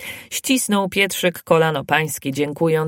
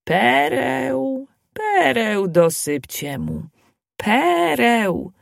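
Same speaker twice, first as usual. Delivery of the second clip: high, slow ringing call; a lightly voiced granny's distant call; 5 semitones higher, much slower than usual. A narrator's reading. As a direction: keep calling like an old wise woman. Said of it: "a precz wołał".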